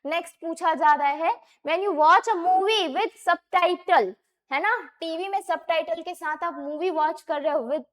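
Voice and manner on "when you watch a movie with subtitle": reading the exam question out slowly, word by word. Shown as a demonstration. Teacher slowly reading a test question aloud. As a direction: spell the question out for students.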